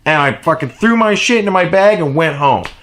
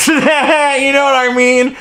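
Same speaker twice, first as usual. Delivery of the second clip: laughing; 9 semitones higher, slower than usual. Real, not acted. Laughing after retold punchline.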